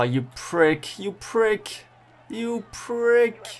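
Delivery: rising exasperated curse. Real, not acted. Gamer cursing the rider who caught him, building up.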